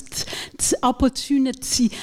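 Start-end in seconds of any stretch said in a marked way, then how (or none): 0.0-0.8 "T, t" as a crisp voiceless release; isolated aspirated T; whispered T. Shown as a demonstration. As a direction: breathe out the T sound. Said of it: "the teeth will close".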